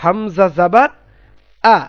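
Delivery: "হামজা জাবার", a pause, then a separate open "আ"; measured recitation of "হামজা জাবার আ"; model pronunciation. Shown as a demonstration. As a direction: spell out "হামজা জাবার আ" for pupils to copy. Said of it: "কি বলবো?".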